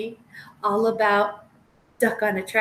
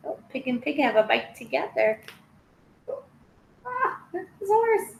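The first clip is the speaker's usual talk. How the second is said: ordinary delivery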